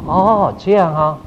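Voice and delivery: slow, acknowledging reaction; encouraging listener. Shown as a demonstration. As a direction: show you are following along. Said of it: "你要有一点表情".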